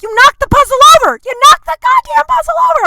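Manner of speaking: high, loud, mock-furious yell she never really said; exaggerated yell. Telling how she wished she'd yelled at the landlord, mock-furious shout. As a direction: blow it up into a shrieking accusation. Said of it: "I didn't say it like that".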